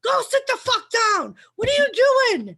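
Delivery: hammed-up retold outburst that cracks into laughter; mock angry shout. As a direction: bark it out, laughing through the anger. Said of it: "He's like".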